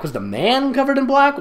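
rising incredulous question; mock confusion. Real, not acted. Reader mocking the story's confusing sentence. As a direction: question the line in disbelief.

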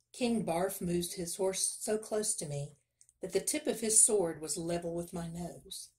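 ordinary delivery

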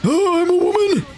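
high-pitched squeal. Pretend. Character transforms, squealing exclaim.

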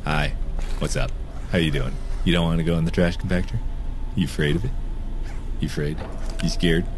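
put-on low, quiet baby-talk teasing; mock taunt. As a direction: tease him like a scared toddler, low and soft.

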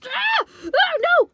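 high panicked cry of no; terrified scream. Acted. Victim screaming before being killed.

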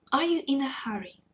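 usual speaking voice

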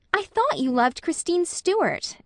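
put-on a high, reproachful accusation of a young woman. A narrator's reading. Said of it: "as reproachfully as".

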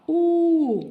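у sung out long, high and loud; teacher voicing the vowel sound for children; isolated vowel. Shown as a demonstration. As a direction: stretch the vowel у, big and clear. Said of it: "Наступний звук груша".

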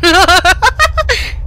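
high, sudden laugh; real amused laughter; burst of laughter. Real, not acted. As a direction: crack up laughing. Laughs at shivering character.

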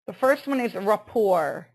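ordinary talk, nothing marked

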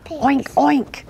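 high little piglet voice; squeaky storybook piglets; piglet oink. A narrator's reading. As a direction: oink like a small pig. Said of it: "say the piglets".

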